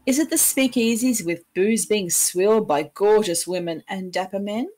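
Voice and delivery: ordinary talk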